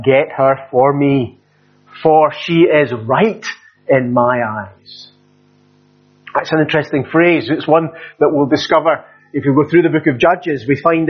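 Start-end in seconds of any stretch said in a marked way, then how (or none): 0.0-5.1 slow, deliberate, headstrong demand; stubborn insistence. Retold or imitated. Samson insists to his father, slow and stubborn. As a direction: lay each word down slowly like a man who won't budge. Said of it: "He said to his father".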